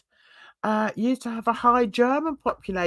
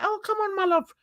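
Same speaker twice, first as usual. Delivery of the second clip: higher, sing-song imitation of the ex-husband; lightly voiced ex coaxing her; mock ex; 10 semitones higher, faster than usual. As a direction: put on a silly coaxing voice. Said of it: "No, he didn't speak like that".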